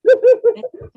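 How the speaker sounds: high loud burst of laughter. Real, not acted. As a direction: crack up loudly.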